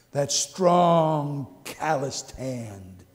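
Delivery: low, slow, hushed and moved; tender, moved. Real, not acted. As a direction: let the memory soften the voice. Tender memory of father.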